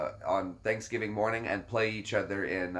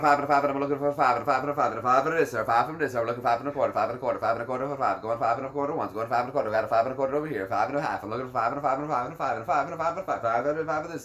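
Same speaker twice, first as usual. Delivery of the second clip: auctioneer; faster than usual. Shown as a demonstration. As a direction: call bids at breakneck speed. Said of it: "The auctioneer voice".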